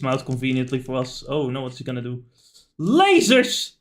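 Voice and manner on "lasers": high, loud burst on one word; excited exclamation. Real, not acted. Player reacts to the boss firing lasers, exclaiming. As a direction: blurt it out high as the attack appears.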